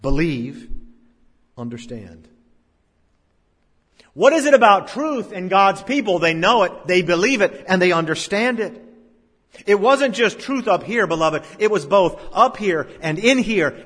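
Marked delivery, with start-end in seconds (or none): 4.1-13.8 loud, high pulpit fervour; full-force preaching. Acted. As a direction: preach it out to the congregation.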